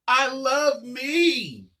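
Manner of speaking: loud, slow, proud declaration; said like you mean it. Shown as a demonstration. Teacher shows the kids how to mean it, loud slow proud shout. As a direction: belt it out slowly like you truly mean it. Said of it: "Say it like you mean it".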